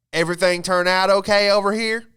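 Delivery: high, loud chipper Southern manager; bright loud "can I help you" manager call. Retold or imitated. Manager checking on the table, chipper and loud. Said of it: "The manager comes over and goes".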